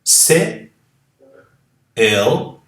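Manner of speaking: "se" then "el" as separate loud syllables; syllable-by-syllable demo; spelling out sounds. Shown as a demonstration. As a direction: break the word into parts. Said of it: "Aqui eu digo".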